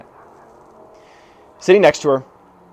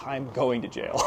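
hushed, lower mock dread; retold freaking out; 4 semitones lower, 13 dB quieter than usual. Nineteen-year-old to himself after confessing, hushed mock panic. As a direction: mutter it as a comic panicked aside. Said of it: "I was literally freaking out".

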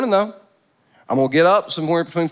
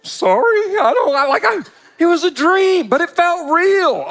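much higher, louder mock wife voice; 13 semitones higher, 4 dB louder than usual. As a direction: squeak it like a wife apologizing for a dream. Voicing wife after the dream.

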